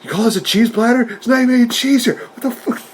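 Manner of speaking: high-pitched, incredulous mock tirade; mock batshit-crazy rant. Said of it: "like, batshit crazy".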